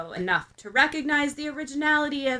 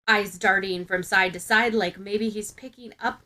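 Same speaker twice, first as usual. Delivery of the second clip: ordinary delivery, nothing marked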